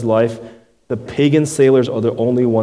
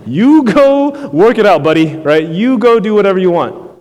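dismissive mock God; 7 semitones higher, 6 dB louder than usual. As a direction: toss the sinner off like a fed-up buddy. Preacher voicing God's dismissal.

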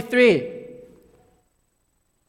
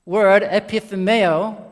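normal talking voice

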